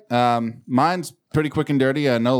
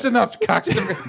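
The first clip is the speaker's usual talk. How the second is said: mock menacing threat; 9 semitones higher than usual. Imagines mother turning on the administrators.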